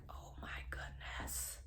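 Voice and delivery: hushed, barely voiced amazement; reliving a stunned realisation; awed whisper. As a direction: whisper it in wonder. Awed realisation in retold vision.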